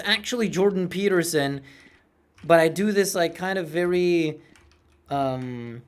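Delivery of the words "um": ordinary talk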